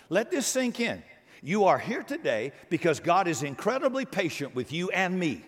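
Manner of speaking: usual speaking voice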